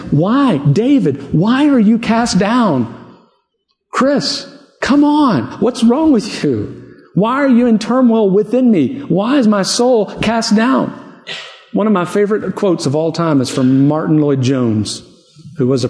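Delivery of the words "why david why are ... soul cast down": higher, slow, exasperated self-talk, pressing "why are you cast down"; psalmist scolding himself. Acted. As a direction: shake yourself out of it, pleading and chiding. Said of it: "He's saying".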